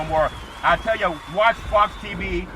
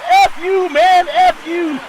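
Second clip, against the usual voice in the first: high, loud, drawn-out retort; comic yelled brush-off; mock defiant shout; 10 semitones higher, 9 dB louder, much slower than usual. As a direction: holler back at the coach, hammed-up. Acting out Ben Simmons's reply.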